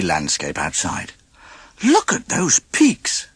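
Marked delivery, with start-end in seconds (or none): 1.8-3.3 breathless high whisper; awed gasp. A narrator's reading. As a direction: gasp it out in awe. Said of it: "gasped Dewey".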